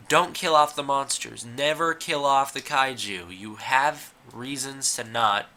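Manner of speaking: ordinary talk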